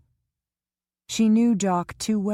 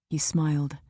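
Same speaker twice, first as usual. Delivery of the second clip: plain reading voice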